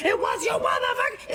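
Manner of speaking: high, yelled accusation; imitated shout. Driver yells at her over the cops, retold shout. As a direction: yell it at her, losing control.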